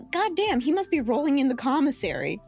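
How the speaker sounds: normal talking voice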